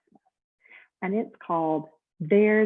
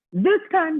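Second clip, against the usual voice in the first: much higher, louder proclamation; declaring; 10 semitones higher, 7 dB louder than usual. Acted. Mister Snore declaring to the bellhop, hammed-up resolve. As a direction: declare it, fed up and firm. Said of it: "declared Mister Snore".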